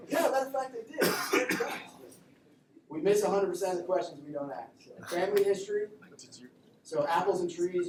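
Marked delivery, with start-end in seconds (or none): 0.1-1.7 much higher and louder, laughing; laughing mock answer. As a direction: blurt it out cheerfully laughing.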